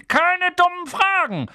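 very high, drawn-out angry shout; snapping. Acted. Uncle cutting off the boy's question, angry shout. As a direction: round on him furiously. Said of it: "fuhr ihn der Onkel an".

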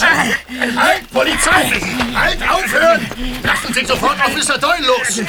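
commanding police shout. Acted. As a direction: yell to stop the fight. Police breaking up a fight.

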